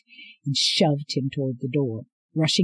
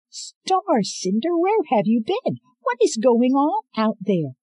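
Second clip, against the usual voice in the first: much higher, loud frantic cry; frantic robot friend; 10 semitones higher, 3 dB louder than usual. Acted. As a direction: burst out in panicked relief. Iko frantic after absence.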